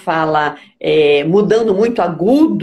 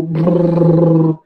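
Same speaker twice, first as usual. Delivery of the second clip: long, sustained lower-pitched "brrr"; vibratory lip trill; 4 semitones lower, 3 dB louder than usual. Shown as a demonstration. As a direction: demonstrate a voice exercise with buzzing lips. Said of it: "o exercício vibratório".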